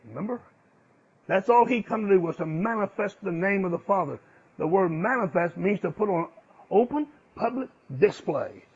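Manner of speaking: usual speaking voice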